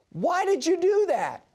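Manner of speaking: high, lightly voiced frog crying out; drowning frog's plea. Frog asks the scorpion while sinking, high and pleading. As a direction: pitch it up like a betrayed little frog.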